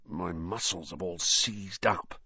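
slow, soft, aching groan. A narrator's reading. Boy back sore from detention, groaning. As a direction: groan it out stiffly. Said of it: "he groaned".

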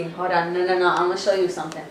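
ordinary talk, nothing marked

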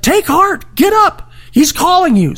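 high, excited urging; rally him to his feet; eager encouragement. Retold or imitated. Crowd urging the blind man up, high and excited. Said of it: "they said".

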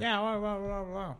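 high-pitched mock phone chatter; oblivious caller. Laptop caller chatting in a coffee shop, high mocking voice. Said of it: "sitting in there on their laptop and they're just like".

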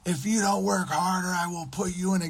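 hammed-up boss ranting, pitched high; mock scream. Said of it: "and he'd scream".

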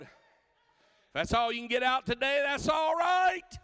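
high, loud and slow, held at a shout; shouted preaching. Acted. Preacher pressing the congregation, loud and high. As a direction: keep the fire going full force.

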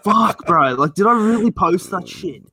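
cringing. Retold or imitated. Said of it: "And I'm like".

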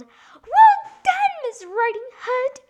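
very high, loud squeal; piercingly high storybook pig yell. A narrator's reading. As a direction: cheer at full pitch as the piglet. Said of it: "Pig peeping through the window stood and yelled".

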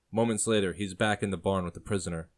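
ordinary delivery

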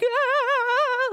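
high joyful cry over the fish. Retold or imitated. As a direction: let out a squealing cry of joy. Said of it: "She's like".

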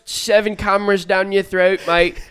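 high, loud, slow mock English prankster. Mocking a street prankster with an English accent, high and loud. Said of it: "trying to prank you on the street".

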